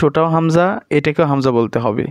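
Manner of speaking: normal talking voice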